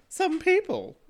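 high, loud mocking squeal; mocking echo. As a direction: parrot it back with exaggerated, high-pitched scepticism. Mocking back partner's vague phrase.

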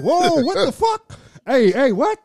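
high, drawn-out disbelief; startled reaction. Retold or imitated. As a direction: recoil in alarm, pitch jumping up. Acting out his startled reaction.

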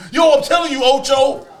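high, loud intense pundit voice; imitating Brandon Marshall. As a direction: hype it up like an overexcited football pundit.

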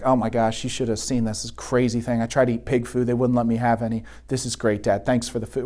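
the returned son rambling to his dad over food; younger son to dad. Retold or imitated. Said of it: "he's like".

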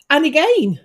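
put-on high "And again"; imitating the medium. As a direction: call it out in mock dismay. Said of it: "she came out with".

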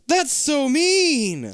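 high, very drawn-out protest; dismayed sympathy. Real, not acted. Listener upset at the cruel remark about Nana, high dismay. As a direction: stretch it out high in pity.

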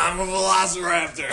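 loud, high-pitched crazed announcement; manic proclamation. Retold or imitated. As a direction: proclaim it like an unhinged roommate. Manic roommate acted out, shouting.

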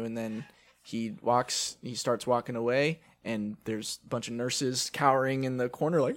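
usual speaking voice

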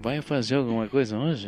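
low, soft flirty question; seductive voice. Shown as a demonstration. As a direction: ask it low like a smooth flirt. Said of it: "o rolê sedutor".

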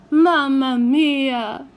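slow, loud Italian groan. A narrator's reading. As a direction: groan it in despair. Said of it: "groaned Signor Maddalena".

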